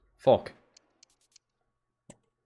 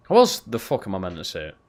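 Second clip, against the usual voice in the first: usual speaking voice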